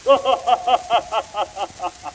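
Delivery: hearty Santa chuckle; jolly laugh. Acted.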